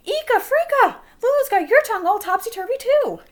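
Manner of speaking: high, loud cry of alarm; flabbergasted outburst. A narrator's reading. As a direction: exclaim it in loud, high-pitched disbelief. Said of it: "Missus Bell's mouth dropped open".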